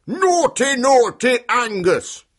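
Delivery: high, loud, slow telling-off; finger-wagging giant's scold; scolding shout. A narrator's reading. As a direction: shout it like an outraged chief giant. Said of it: "shouted the chief giant".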